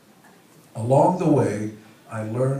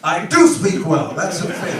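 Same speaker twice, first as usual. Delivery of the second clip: mock protest; 7 semitones higher, 9 dB louder than usual. Protesting the heckle.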